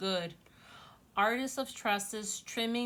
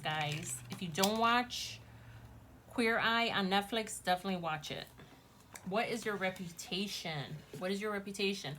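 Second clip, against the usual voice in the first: usual speaking voice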